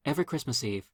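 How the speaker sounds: ordinary delivery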